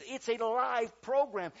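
loud, high, drawn-out protest; mock-frantic call down the phone, slowly stressed; retold exasperated shout.

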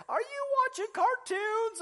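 high, slow teasing question; wife's voice. Retold or imitated. Preacher imitating his wife calling from the other room, high. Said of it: "she says".

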